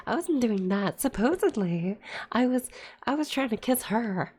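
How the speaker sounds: real outrage spilling out; heated. Real, not acted. Streamer ranting to chat about a childhood injustice, heated. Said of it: "Sorry, I got a little heated there".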